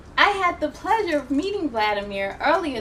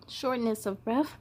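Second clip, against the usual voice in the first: plain reading voice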